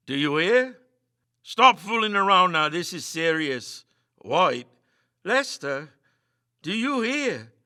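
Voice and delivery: loud, high, urgent calls; panicked shouting. Acted. Meagle yelling at his sleeping friends, panicked. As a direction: shake them awake in fear.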